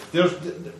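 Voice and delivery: ordinary talk, nothing marked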